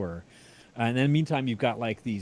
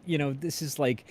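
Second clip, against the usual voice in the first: normal talking voice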